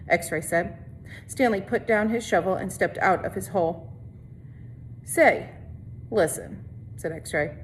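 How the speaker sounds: steady read-aloud narration for a class; story narration. A narrator's reading. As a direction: read the chapter to the students, evenly. Teacher reads novel to class.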